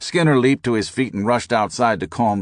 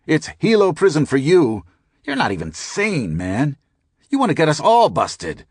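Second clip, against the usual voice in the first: high, louder furious rant; 8 semitones higher, 4 dB louder than usual. A narrator's reading. Skinner rages at the narrator, high and loud. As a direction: rant at him, out of control.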